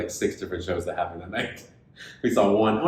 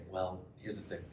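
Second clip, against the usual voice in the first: hushed retold lie; 3 semitones lower, 8 dB quieter than usual. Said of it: "to Nikki and I say".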